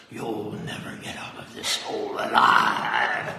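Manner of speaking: low, slow, ghostly whisper; deep ghostly voice. Acted. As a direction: breathe it out like a ghost from the grave. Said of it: "in a really deep, scary, and ghostly voice".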